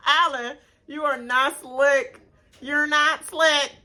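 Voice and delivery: slow, high mock scolding; playful slow call-out; teasing. Real, not acted. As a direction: drag it out, teasing him. Teasing a cast member.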